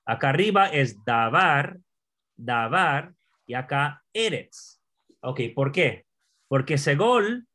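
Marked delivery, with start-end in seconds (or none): 1.0-3.1 davar with the weight on var; da-VAR, stressed at the end; stress on second syllable. Shown as a demonstration. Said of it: "davar con énfasis acá atrás". 4.1-4.8 eretz stressed on its opening e; E-retz, falling after the first syllable. Shown as a demonstration. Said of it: "con énfasis aquí".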